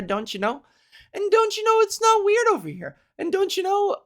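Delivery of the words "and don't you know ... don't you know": put-on high-pitched Canadian accent caricature; mock Canadian accent. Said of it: "That's how they talk in my area of Canada".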